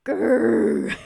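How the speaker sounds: loud, pathetic grizzly bear growl; badly faked metal growl, blasted out; attempted metal growl. Shown as a demonstration. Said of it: "I couldn't do a metal growl".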